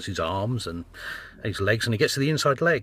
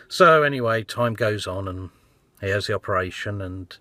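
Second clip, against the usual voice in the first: usual speaking voice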